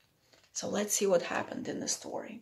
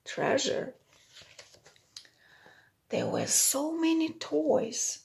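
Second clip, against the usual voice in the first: ordinary delivery, nothing marked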